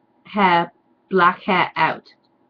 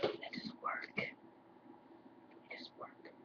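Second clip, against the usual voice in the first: hushed deadpan complaint; very slow whispered critique; whispered aside; 29 dB quieter, much slower than usual. Real, not acted. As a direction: whisper it, the second time very slowly.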